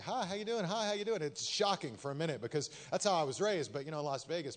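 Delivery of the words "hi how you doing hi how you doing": high, bright mock-friendly Texan hello; friendly Texan greeting. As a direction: greet a stranger like a cheery Texan neighbor. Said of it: "Everybody's like".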